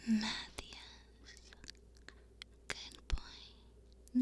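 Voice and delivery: unvoiced whisper of praise. Real, not acted. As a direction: whisper it close to the mic. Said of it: "I can whisper you some names".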